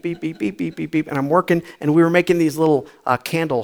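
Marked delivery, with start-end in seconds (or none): 0.0-1.1 soft rapid background beeps; imitated bleeped swearing. Recalling swearing heard while working, soft rapid beeping. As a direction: patter the beeps like constant chatter. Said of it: "all I'm hearing is the beep".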